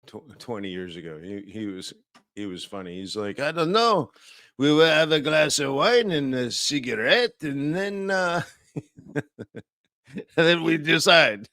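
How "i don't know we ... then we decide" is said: lightly put-on French accent, raised higher; hammed-up French accent for a relaxed central banker; a loose, half-hearted French accent; bad French accent. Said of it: "That's a terrible French accent".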